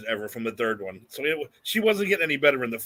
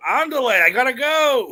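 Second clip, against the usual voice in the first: much higher, louder mock call of the mother; hammed-up mother; 9 semitones higher, 6 dB louder, slower than usual. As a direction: belt out a silly Andale like a fleeing mom. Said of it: "She goes".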